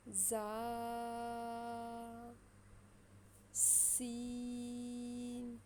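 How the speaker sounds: each letter name stretched very long and slow; drawn-out letters. Shown as a demonstration. Teacher stretching each letter name long for students. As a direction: hold every letter long like a chant. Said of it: "ہم نے ان کو لمبا پڑھنا ہے".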